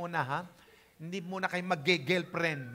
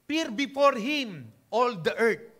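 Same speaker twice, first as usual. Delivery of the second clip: slow, solemn psalm recital in a changed voice; scripture reading. Acted. Preacher reading David's psalm to the congregation, slow and solemn. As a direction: proclaim the verse like King David. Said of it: "Sabi niya".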